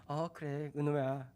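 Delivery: soft, hushed comforting of an infant; soothing a crying baby. Retold or imitated. Father comforting his crying baby son, hushed and tender. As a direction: murmur gently, like rocking a baby. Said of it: "이렇게 했겠죠".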